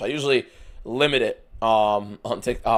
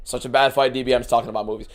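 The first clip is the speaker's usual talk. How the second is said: ordinary talk, nothing marked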